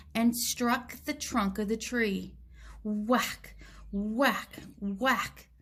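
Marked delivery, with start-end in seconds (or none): none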